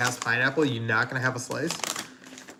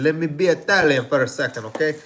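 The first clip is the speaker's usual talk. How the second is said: hammed-up Italian accent, louder; mock Italian accent; 3 semitones higher, 7 dB louder than usual. Shown as a demonstration. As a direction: play it like a cartoon Italian chef, louder. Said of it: "if that was a good accent".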